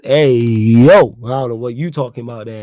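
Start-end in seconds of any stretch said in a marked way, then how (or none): 0.0-1.2 loud call across the room to get attention. Real, not acted. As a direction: shout it out to someone away from the mic. Calling out to someone off mic.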